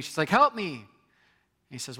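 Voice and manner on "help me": high, loud cry for help; a woman yelling up to the king on his balcony for help; retold yell. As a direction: call out to the king in desperation. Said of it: "the one lady yells out to the king".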